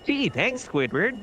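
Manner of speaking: high-pitched cartoon voice; high, swooping mock-cartoon thanks; cartoon character impression. Cartoon impression answering the other player.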